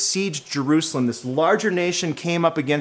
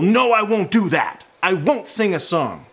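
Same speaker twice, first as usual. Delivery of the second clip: firm refusal; 5 semitones higher, 4 dB louder than usual. Retold or imitated. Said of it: "strong statement of refusal".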